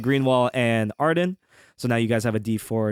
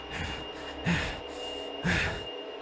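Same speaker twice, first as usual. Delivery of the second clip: long, quiet breathy exhale; drawn-out heavy breath; 5 semitones higher, 11 dB quieter than usual. Acted. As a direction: breathe out slowly like the swordsman. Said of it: "he's just kinda breathing over the".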